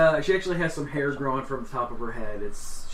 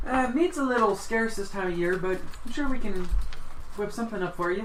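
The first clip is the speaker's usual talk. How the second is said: higher-pitched helpful hostess voice; obliging dragonborn cafe hostess; 8 semitones higher than usual. Acted. Cafe woman to customers ordering breakfast, obliging higher voice. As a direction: play an accommodating server in a raised voice.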